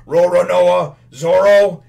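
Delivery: loud, high shout of a name; confronting shout. Retold or imitated. Sergeant cuts off the fleeing swordsman, confronting shout. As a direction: call him out at full voice.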